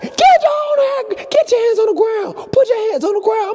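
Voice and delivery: yelling cop. Retold or imitated. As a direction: shout orders like a panicked cop. Imitating police officer yelling.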